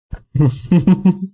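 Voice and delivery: high-pitched loud laughter; laughing. Real, not acted. Streamer thanking the troll, high loud laughter. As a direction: laugh hard, pitched well up.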